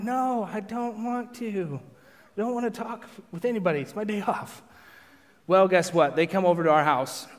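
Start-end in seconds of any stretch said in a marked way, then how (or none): 0.0-4.6 high-pitched mock whine; whiny reluctance. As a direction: protest high, without raising the volume. Acts his reluctance to talk.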